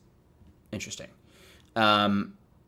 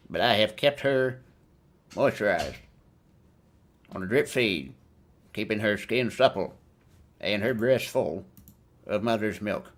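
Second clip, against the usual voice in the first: propaganda broadcaster. Pretend. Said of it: "Samuel Hurd's voice".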